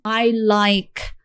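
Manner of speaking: usual speaking voice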